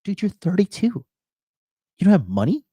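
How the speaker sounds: high, slow, drawn-out disbelief; incredulous. Retold or imitated. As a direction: stretch it out like you can't believe it. Retold disbelief at broke friend.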